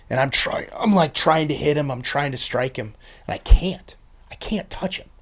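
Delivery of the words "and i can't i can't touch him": high, unvoiced strained whisper; straining, the voice gives out; voiceless gasp of helplessness; breathless whisper. Reliving helpless struggle.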